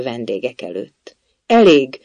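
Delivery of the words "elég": loud, cutting outburst; storybook royal yell; queen's bellow. A narrator's reading. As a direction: halt them with one loud word. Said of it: "üvöltött a királynő".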